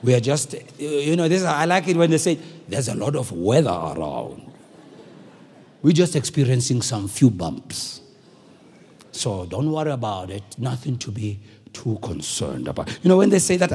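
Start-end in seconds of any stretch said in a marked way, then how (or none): 0.0-0.5 very low, measured cockpit announcement; pilot's wide voice. Acted. Pilot coming on to address passengers, wide low voice. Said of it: "comes on with that very wide voice". 2.7-12.9 very low, measured cockpit announcement; pilot's wide voice. Acted. Pilot coming on to address passengers, wide low voice. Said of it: "comes on with that very wide voice".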